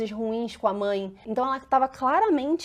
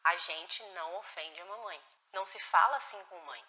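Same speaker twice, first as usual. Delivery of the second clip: much softer, firm gentle rule; calm mantra; 5 semitones lower, 9 dB quieter than usual. Shown as a demonstration. Mother reciting the family mantra to her child, much softer. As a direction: repeat the house rule calmly. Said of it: "E fala o tal do mantra".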